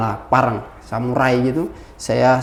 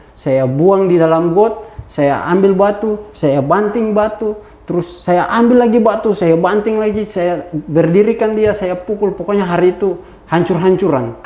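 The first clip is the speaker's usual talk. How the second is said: ordinary delivery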